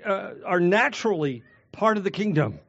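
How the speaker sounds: ordinary talk, nothing marked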